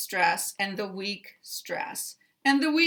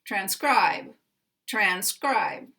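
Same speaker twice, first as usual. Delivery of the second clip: "Transcribe" with SCRIBE strongest, tran secondary with a full vowel; stress on second syllable; slower than usual. Shown as a demonstration. Teacher modelling secondary then primary, "transcribe" stressed on SCRIBE. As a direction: hit the end of "transcribe", keep the first vowel full. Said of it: "primary stress on the second syllable".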